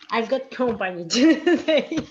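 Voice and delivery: breaking into laughter mid-sentence; laughing. Real, not acted. Student apologises for company during the lesson, laughing.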